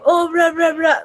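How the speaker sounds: imitating gamers trash talking in the lobby; mocking lobby troll. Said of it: "every time you get in the lobby".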